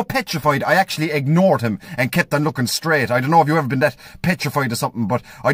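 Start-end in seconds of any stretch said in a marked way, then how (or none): none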